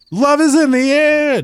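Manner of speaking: high, slow mocking croon; teasing singsong. A player teases the arguing couple in a high, slow mock-romantic croon. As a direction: tease the bickering pair, drawn out high.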